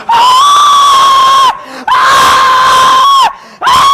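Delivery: very high, very loud screams; rock screams. Acted. Belting along with the soundtrack in wild screams. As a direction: shriek like a metal singer.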